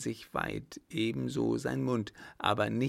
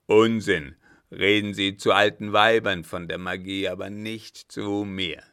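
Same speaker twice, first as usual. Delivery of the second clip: loud, scornful dismissal; contemptuous captain; 7 dB louder than usual. Acted. Captain scorns the German's magic, loud and contemptuous. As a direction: slap the nonsense down.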